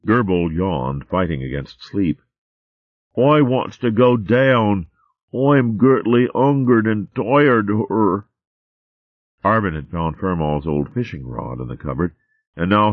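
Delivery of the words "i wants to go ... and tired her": slow, high, whiny mole dialect; drowsy little mole, drawn out; sleepy mole babe. Acted.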